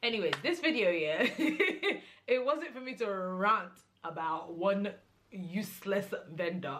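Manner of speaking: laughing through the words. Real, not acted. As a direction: laugh at herself for getting worked up. Laughing off her rant.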